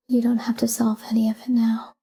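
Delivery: normal talking voice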